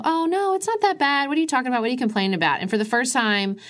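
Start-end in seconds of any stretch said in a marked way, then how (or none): 0.0-2.6 high, mocking brush-off voice; dismissive women. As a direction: imitate them waving it away, pitched up. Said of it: "they'd all go".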